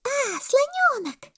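very high, drawn-out squeal; monkey's cry. A narrator's reading. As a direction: greet a friend from the treetop. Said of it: "закричала мартышка с пальмы". (мартышка кричит, тоненько и протяжно)

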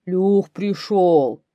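loud, very slow bear roar. A narrator's reading. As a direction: drag it out like a roaring bear. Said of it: "ревёт медведь". (медведь ревёт, громко и протяжно)

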